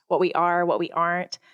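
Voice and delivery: normal talking voice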